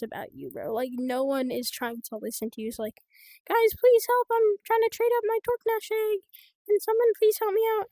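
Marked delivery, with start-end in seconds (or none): 3.5-7.9 high, loud mock begging; mock pleading player. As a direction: imitate a needy player pleading for help. Imitates an ignored pleading player.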